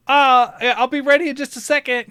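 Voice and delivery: high, quick, breezy put-on woman's line; clichéd date. Woman to her date before walking off, high clichéd voice. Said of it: "kinda, like, cliche thing".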